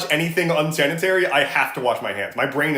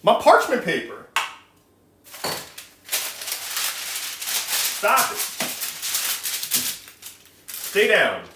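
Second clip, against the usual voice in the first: scolding the dog; 7 semitones higher than usual. Real, not acted. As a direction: yell at the dog to stop.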